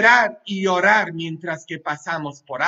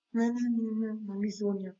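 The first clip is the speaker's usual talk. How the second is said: voz de clienta fingida; 11 dB quieter than usual. Pretend. Broma de uñas largas, voz fingida.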